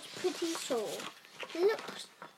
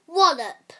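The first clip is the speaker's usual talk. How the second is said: big loud crash word; shouted wallop; 3 semitones higher, 14 dB louder than usual. A narrator's reading. Reading the refrain to the children, loud shout. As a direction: slam it out.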